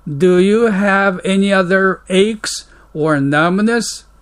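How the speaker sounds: slow, careful English read for learners; slow model reading. Shown as a demonstration. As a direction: read each word out slowly and clearly. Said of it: "English model dialogue read slowly". (ゆっくり丁寧な英語の模範読み)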